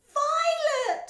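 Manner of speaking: very high, loud scream; mother screaming at her swelling daughter. A narrator's reading. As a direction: scream in alarm. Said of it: "screamed Missus Beauregard".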